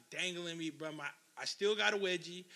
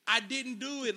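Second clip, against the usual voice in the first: high, louder protest of innocence; desperate denial; 6 semitones higher, 5 dB louder than usual. Retold or imitated. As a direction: plead innocence under a beating. Kid's denial while being hit.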